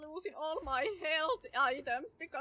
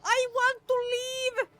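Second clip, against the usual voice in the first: high crying shout, slowed at first; half-laughing terror; 7 semitones higher, 4 dB louder than usual. Real, not acted. Player wailing to the monster, high crying shout. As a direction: wail it like a hammed-up horror victim.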